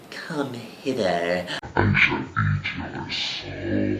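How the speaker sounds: slow, dropping to a low demonic growl; hammed-up soul-eater; mock villain. Mocking the temptress as a slow, low demon. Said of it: "She's like".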